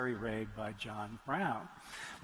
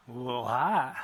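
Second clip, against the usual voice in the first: a touch of a Clinton voice, louder; lightly voiced charming president leaning down; Clinton greeting; 7 dB louder than usual. To the young daughter, a mock Clinton greeting with more volume.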